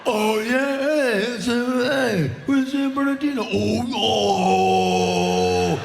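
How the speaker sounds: high, loud dazed whooping; stoned crowd cheer. Retold or imitated. Stoned fans cheering back at the frontman. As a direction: cheer like you've forgotten where you are. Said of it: "everyone's like".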